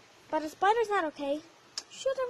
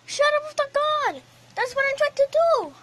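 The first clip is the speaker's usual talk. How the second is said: shouting higher and louder; yelling; 6 semitones higher, 8 dB louder than usual. Real, not acted. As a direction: yell it over the noise. Yelling at Mario mid-game.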